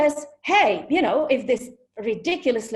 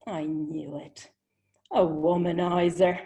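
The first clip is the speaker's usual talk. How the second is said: knowing verdict; 4 semitones lower than usual. Retold or imitated. She decides the charming Englishman is a womanizer, knowingly. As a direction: drop it like a confirmed suspicion.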